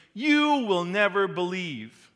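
slow, loud and high, stern and final; harsh emphasis. Acted. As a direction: drive each word home like a verdict. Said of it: "I put some pretty harsh emphasis".

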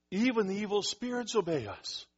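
high, loud amazement; blown away. Retold or imitated. Disciples coming back to tell Jesus, amazed. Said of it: "They were, like, blown away".

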